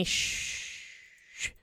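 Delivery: breathy unvoiced shushing; soft whispered shh. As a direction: hush him in a whisper. Shushing him during oral.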